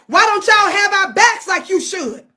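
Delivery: normal talking voice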